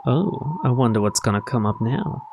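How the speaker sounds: ordinary delivery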